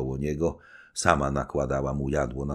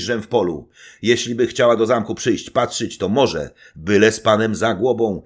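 louder, firm orders; commanding knight; 5 semitones higher, 7 dB louder than usual. Acted. Knight giving his servant orders before battle, firm. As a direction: command briskly.

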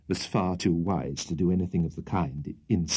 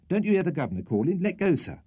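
fast, high urgent plea; 10 semitones higher, faster than usual. Acted. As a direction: hurry him, he must let go. Sam urging Winkle to let go.

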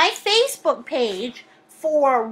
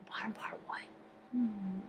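breathy whisper to herself; 7 semitones lower, 25 dB quieter than usual. Real, not acted. Repeating a viewer's comment to herself, whispered. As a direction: whisper the words under her breath.

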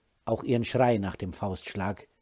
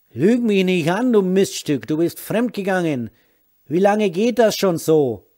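furious yell; 8 semitones higher, 10 dB louder than usual. Acted. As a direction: shout it, much louder and higher. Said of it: "Er schrie immer lauter". (wütend schreiend, laut und hoch)

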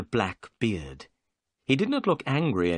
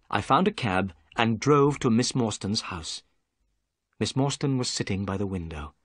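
ordinary delivery

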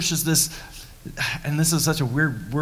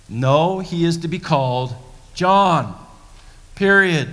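louder, firm, falling finality on John; emphatic declaration; 2 semitones higher, 3 dB louder, slower than usual. Acted. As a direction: plant your feet on each word. Preacher restates Elizabeth emphatically.

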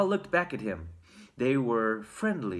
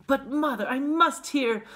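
put-on high, insistent child protest; raised, high little-boy plea; pleading boy; 11 semitones higher, 4 dB louder than usual. A narrator's reading. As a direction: beg mother urgently, pitched way up. Boy protests against bedtime.

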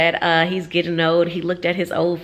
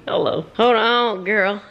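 usual speaking voice

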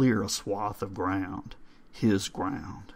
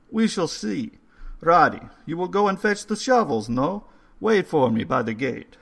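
put-on higher, louder old foreign gentleman; old caretaker voice; 8 semitones higher, 6 dB louder than usual. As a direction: read the stone like a courteous old man. Old man gives orders.

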